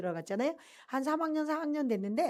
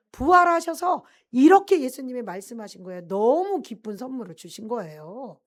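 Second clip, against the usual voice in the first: loud, fervent preaching; preaching at full force; 5 semitones higher, 5 dB louder than usual. Acted. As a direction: proclaim it to the congregation. Preaching testimony climax. (열정적인 설교)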